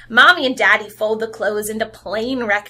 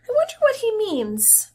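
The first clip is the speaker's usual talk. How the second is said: high, puzzled wondering; 10 semitones higher than usual. Acted. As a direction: sound comically puzzled. Teacher puzzles to the class.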